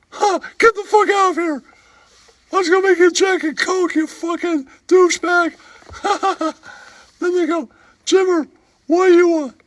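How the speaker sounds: high, squeaky put-on character voice, with giggles; squeaky comic voice. Comic sidekick telling a bar story in a squeaky giggling voice. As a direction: play a giggling oddball sidekick. Same held voice.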